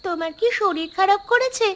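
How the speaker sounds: put-on a little girl calling to her sick father; young daughter. Daughter finds father lying down.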